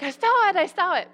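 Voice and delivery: high, loud and insistent; hammed-up eager pretending; eager fake claim. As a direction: blurt it out to keep up with the class. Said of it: "I'm like".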